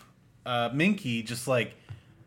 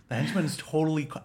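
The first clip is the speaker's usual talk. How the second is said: ordinary talk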